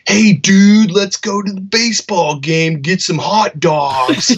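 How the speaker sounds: loud, high, hammed-up American accent; mock an exaggerated American accent, dragging the last words. Imitating Australian tour manager's go at an American accent. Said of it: "he would do an American accent".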